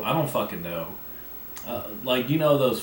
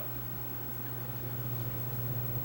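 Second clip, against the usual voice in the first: harsh dry coughing; coughing fit; 12 dB quieter than usual. Real, not acted. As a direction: cough hard, can't stop. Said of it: "I'm sorry that I'm coughing".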